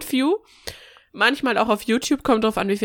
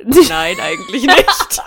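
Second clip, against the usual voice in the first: laughing; 12 semitones higher, 8 dB louder, much slower than usual. Real, not acted. Laughing refusal of closing words.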